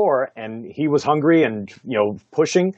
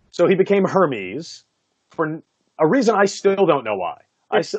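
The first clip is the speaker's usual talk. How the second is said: ordinary talk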